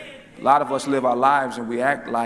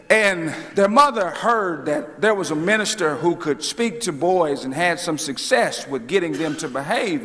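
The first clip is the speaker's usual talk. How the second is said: usual speaking voice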